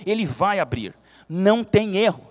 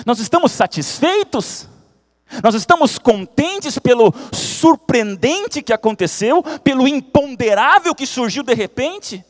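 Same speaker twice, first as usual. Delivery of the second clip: preaching; 5 semitones higher, 6 dB louder than usual. Acted. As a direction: press each question on the congregation. Preacher asks congregation.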